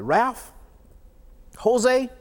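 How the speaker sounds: calling names out high and loud; rallying call. Retold or imitated. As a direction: call them to rise up.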